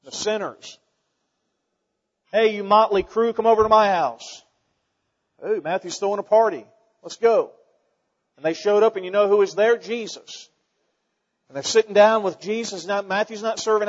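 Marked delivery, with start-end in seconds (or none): none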